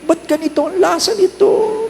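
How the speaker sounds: high squeaky confused complaint; mock complaining guest. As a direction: play the baffled eater, high. Guest complains about taste.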